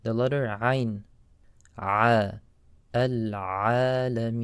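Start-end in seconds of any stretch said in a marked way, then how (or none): 2.9-4.4 al-alameen with the ayn squeezed from the throat, slow. Shown as a demonstration. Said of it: "should come from the throat".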